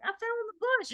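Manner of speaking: daughter's voice. Retold or imitated. Daughter quoted in retold story.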